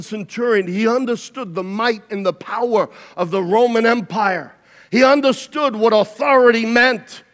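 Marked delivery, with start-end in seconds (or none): 4.9-7.2 higher and louder, building to a shout; full-force preaching. Acted. Preacher lifting the church to a shouting climax. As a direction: preach it up to a roar.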